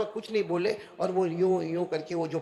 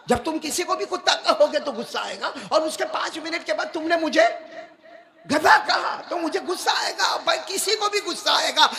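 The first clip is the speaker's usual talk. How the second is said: loud, higher indignant protest; angry retort; 9 semitones higher, 6 dB louder than usual. Retold or imitated. Avicenna protesting to the maulana about the insults, angry loud retort. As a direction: complain hotly that anyone would get angry. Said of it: "ابو علی سینا تو غصے میں تھے".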